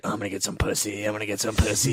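low, soft, creepy toad; horny toad voice. Retold or imitated. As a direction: mutter lecherously like a disgusting toad. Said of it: "being a horny, disgusting toad".

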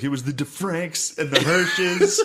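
high slow whine through laughter; whiny laughing plea. Retold or imitated. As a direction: plead innocence, half laughing. Blaming others to mom.

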